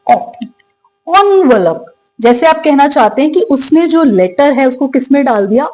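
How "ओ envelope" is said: ओ alone, then envelope as "ON-vuh-lup"; correct first vowel. Shown as a demonstration. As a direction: isolate ओ, then stretch it into envelope. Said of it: "इसको आप क्या पढ़ते हैं".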